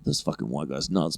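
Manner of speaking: a quiet imagined remark; muttered thought. Retold or imitated. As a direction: say it under your breath.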